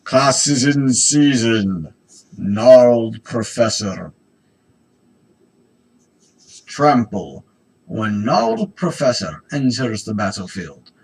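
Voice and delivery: slow, loud mushroom creature voice; mycoid voice. Acted. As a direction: boom it out like a fungal monster. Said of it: "that was more of my mycoid voice".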